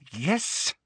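high, rising question; barely tolerate the interruption; tightly controlled annoyance; strained patience. Acted. Professor praying for patience.

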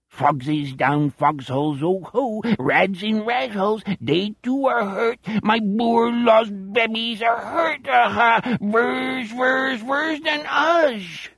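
high, slow, singsong alien croon; extraterrestrial accent. A narrator's reading. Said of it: "accent of an extraterrestrial".

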